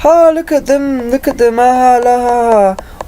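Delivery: high, taunting sing-song jeer; mock the victim from above, gleeful; mocking laughter. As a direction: abusers jeering at a broken victim, high taunting. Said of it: "they're gonna be sitting there going".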